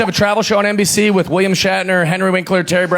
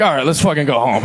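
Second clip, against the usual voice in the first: fed up; 7 semitones lower than usual. Retold or imitated. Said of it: "a week before you're like".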